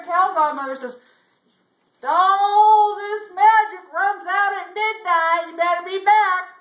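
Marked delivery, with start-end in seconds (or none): 2.1-6.5 high, loud, drawn-out holler; calling out. Acted. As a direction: holler the warning after someone riding away. Godmother calls after departing rider.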